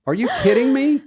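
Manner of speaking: high, loud "are you kidding me"; disbelief. Real, not acted. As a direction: squeal of real disbelief. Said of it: "I'm totally not joking".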